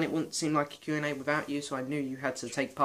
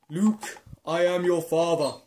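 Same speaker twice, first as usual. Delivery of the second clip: bad Darth Vader voice; 2 semitones higher, 5 dB louder, slower than usual. Vlogger voicing Vader with action figures, slow mock Vader. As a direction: ham up a Vader impression, slowly. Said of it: "my Vader voice because it's absolutely awful".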